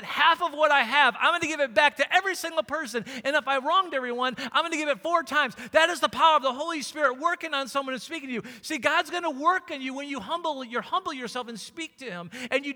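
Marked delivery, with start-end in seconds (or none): 0.0-8.4 loud, high, emphatic sermon delivery; full-force preaching. Real, not acted. Preacher telling church God will speak to them, full-force preaching. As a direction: preach it at full volume, pitched up.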